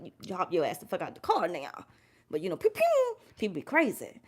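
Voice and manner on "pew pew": high, quick "pew, pew" cartoon gun sounds; playful imitated shots; joking finger-gun sound effects; mock gunshot noises. Mimics gunshots about road rage.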